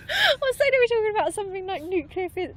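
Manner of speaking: high, loud, laughter-choked voice; real giggling fit while speaking; cracking up. Real, not acted. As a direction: talk helplessly through your own laughter. Cracking up at own example.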